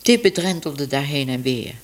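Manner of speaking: ordinary delivery, nothing marked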